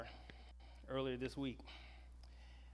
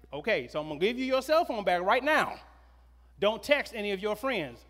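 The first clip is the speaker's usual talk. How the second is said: louder, higher, emphatic mother; louder stern mother voice; firm mom repeat; 5 semitones higher, 6 dB louder than usual. Retold or imitated. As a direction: repeat the rule firmly, pressing it home. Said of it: "But Trina said".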